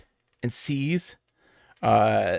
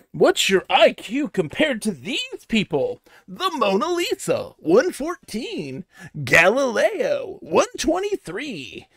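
higher, slow, hammed-up ad-voice reading; mock ad announcer; 8 semitones higher, slower than usual. Mocking a dumb phone ad to chat, hammed-up announcer. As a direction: play a cheesy mobile-game ad, drawn out. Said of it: "I got an ad that was like".

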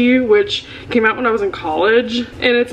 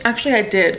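normal talking voice

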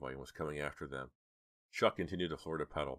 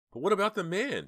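high, loud objection; high-pitched worried challenge; protesting; 8 dB louder than usual. A narrator's reading. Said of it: "Dina protested".

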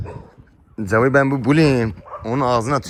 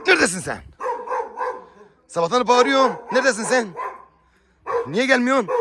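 calling out; 11 semitones higher than usual. Real, not acted. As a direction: call out for the missing pet. Calling missing pet.

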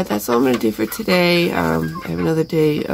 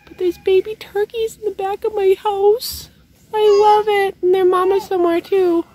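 delighted. Real, not acted. Delighted at baby turkeys.

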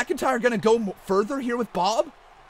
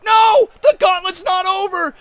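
loud protest; 8 semitones higher, 8 dB louder than usual. Acted.

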